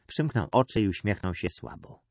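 ordinary delivery, nothing marked

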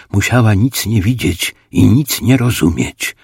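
plain reading voice

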